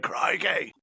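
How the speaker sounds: high, bright Aussie rock star; Australian accent. Retold or imitated. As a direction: ham up an Australian frontman. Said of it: "He was like".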